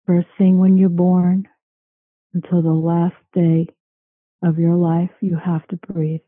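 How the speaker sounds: slow, calm meditation voice; guided meditation. Acted. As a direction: guide them into calm, slowly. Leads a guided relaxation.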